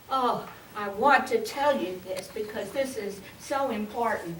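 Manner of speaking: a hint of a pushy believer; mock earnest churchgoer. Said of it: "you'll see this in churches".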